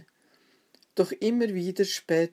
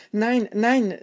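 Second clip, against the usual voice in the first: higher, louder repeated denial; alarmed cry; 5 semitones higher, 6 dB louder than usual. A narrator's reading. Peter denying Jesus to another maid, crying out. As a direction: protest out loud in alarm. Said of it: "rief er noch einmal".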